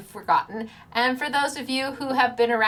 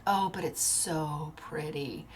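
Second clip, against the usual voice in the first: softer and slower, gently pleading; coaxing aside; 6 semitones lower, 9 dB quieter, slower than usual. Pretend. Crafter coaxing doubtful viewers about paper, soft and slow. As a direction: coax them, it's so pretty.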